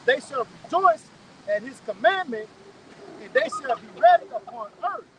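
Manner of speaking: slow, loud, emphatic scripture reading; measured, ringing recitation with pauses between phrases. Real, not acted. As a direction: proclaim each phrase slowly and forcefully. Reads scripture aloud emphatically.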